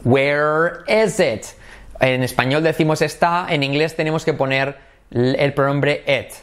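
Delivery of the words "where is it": every word drawn out slowly; slow question. Shown as a demonstration. Teacher drilling the pronoun it, slowly. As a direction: say "Where is it" very slowly. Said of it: "¿Dónde está?".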